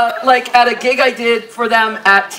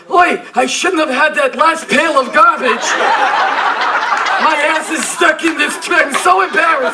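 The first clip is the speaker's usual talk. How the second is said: raccoon voice; 5 semitones higher than usual. Pretend. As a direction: shout it like a stuck raccoon. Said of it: "I'm like".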